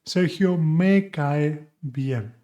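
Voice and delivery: slow and deliberate, each word clear; slow teaching demo; slow model sentence. Shown as a demonstration. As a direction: say it slowly for learners to copy. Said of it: "That's better to use".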